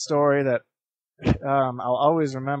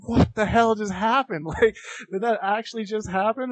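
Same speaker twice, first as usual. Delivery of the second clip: higher-pitched, stunned questioning; retold disbelief; 7 semitones higher than usual. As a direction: replay your own astonishment. Retold disbelief after rescue.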